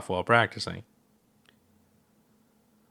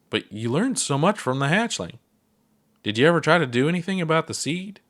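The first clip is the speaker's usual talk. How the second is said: eager; 7 semitones higher than usual. Acted. Ponder presses Gabbro.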